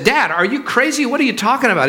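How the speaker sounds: high-pitched, incredulous outburst at dad. Acted. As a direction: raise your voice in disbelief. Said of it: "He started raising his voice at his father".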